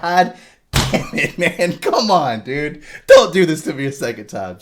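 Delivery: high, loud mock despair; hammed-up frustrated outburst; exasperated pleading. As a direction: protest loudly to the screen. Acted exasperation at rewatching the death.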